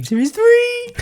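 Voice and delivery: very high, drawn-out "three"; high-pitched exclamation. Pretend. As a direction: call it out in a high falsetto. Running jingle-like high call.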